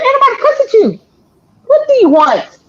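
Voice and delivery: high protest that drops at the end; exasperated retort. Retold or imitated. Grandchild answering grandmother's accusation, exasperated retort. Retold argument with grandmother on phone.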